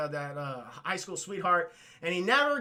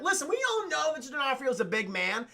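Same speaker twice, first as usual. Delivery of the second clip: usual speaking voice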